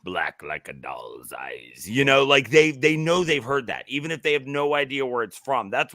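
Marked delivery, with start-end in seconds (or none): 0.0-1.9 very low, slow, hushed movie-quote voice; Jaws monologue quote. Acted. Quoting Jaws for a co-host, in a low, slow monologue voice. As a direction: drop deep and draw the line out slowly. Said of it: "People who have never seen Jaws know".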